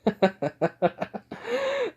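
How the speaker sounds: high-pitched villainous laugh; acted laughing fit. Hero laughs after the thugs flee, laughing. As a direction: burst into a long, high cackle. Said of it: "he just starts laughing".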